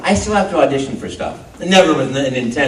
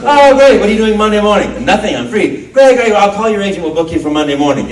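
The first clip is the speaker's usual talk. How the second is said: higher, louder, eager re-enactment; delighted producer; 5 semitones higher, 6 dB louder than usual. Retold or imitated. Producer offers the actor Monday's job, delighted. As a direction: act out an enthusiastic booker. Producer books him on the spot.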